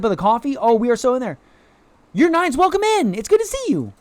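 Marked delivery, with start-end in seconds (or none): 2.1-3.9 very high-pitched gushing greeting; excited welcome. Real, not acted. Streamer welcoming another viewer into chat, excited. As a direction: greet them in a high, eager voice.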